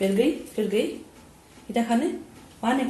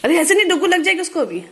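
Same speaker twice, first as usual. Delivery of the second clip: alarmed warning; 6 semitones higher, 4 dB louder, faster than usual. Real, not acted. Mother warning her baby, raised alarmed voice.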